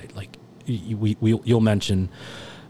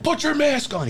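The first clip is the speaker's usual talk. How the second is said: mock yell; 4 dB louder than usual. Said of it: "He's, like, yelling at me".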